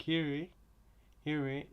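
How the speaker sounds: normal talking voice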